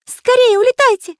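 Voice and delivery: very high, loud plea; Карандаш pleading urgently. A narrator's reading. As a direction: urge the pilot to take off, crying out. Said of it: "попросил Карандаш лётчика". (высокий умоляющий крик)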